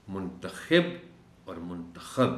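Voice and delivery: منتخب first as muntakhib, then as muntakhab; two vowelled forms. Shown as a demonstration. Teacher shows two words from same letters, منتخب said slowly both ways. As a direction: slowly contrast -khib and -khab in منتخب. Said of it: "دو الفاظ بنتے ہیں".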